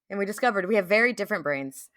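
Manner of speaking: ordinary talk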